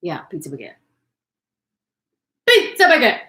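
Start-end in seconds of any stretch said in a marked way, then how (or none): 2.5-3.2 high, loud excited yell; shout. Real, not acted. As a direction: belt it out with glee. Shouting the phrase.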